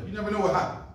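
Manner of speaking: usual speaking voice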